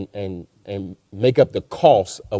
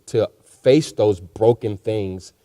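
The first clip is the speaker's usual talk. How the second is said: slowed right down, each word weighed; slow emphasis; much slower than usual. Real, not acted. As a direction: lean on it slowly, like a preacher's point. Repeats phrase for emphasis.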